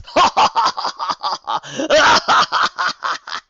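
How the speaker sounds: loud, booming scornful laugh; mocking laugh. A narrator's reading. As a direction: laugh in her face. Commander laughs aloud.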